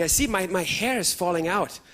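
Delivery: ordinary talk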